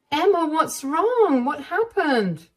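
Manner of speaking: gently alarmed, ask what's wrong; worried mother-in-law. Acted.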